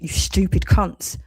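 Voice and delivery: ordinary talk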